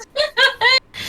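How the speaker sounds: squeaky burst of laughter; high laugh. Real, not acted. Laughs at the death.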